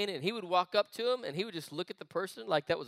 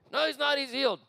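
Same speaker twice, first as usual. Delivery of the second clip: high, insistent English-accent imitation; 7 semitones higher than usual. Healer reassuring the panicked pastor, in an English accent. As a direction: mimic an English preacher correcting someone. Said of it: "'Cause he had this English accent".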